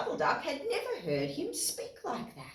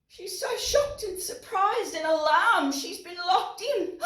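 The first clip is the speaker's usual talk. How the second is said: shocked excitement; 8 semitones higher than usual. Acted.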